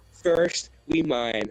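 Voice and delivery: rally the troops grandly; rallying leader speech. Acted. Team leader rallying his group, slow grand speech.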